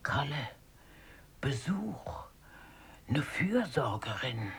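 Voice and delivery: low, unvoiced whisper; warning whisper. Oma secretly warns Kalle, whispering. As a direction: hiss a warning so the visitor won't hear.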